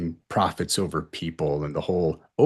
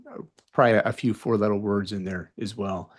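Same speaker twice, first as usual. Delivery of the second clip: usual speaking voice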